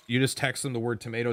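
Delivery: normal talking voice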